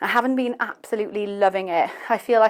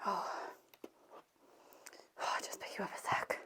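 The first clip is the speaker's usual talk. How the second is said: quick breathy whisper, almost silent; hushed quick aside while lifting the camera; 19 dB quieter, faster than usual. Real, not acted.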